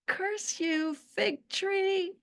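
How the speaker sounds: mock the cruel master through laughter; mock curse laughing. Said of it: "with a huge grin ... laughing and laughing".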